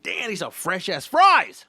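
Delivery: loud, high burst of delight; hammed-up excited rave; thrilled exclamation. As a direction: stretch it out, blown away by the food. Acted delight at fresh fries.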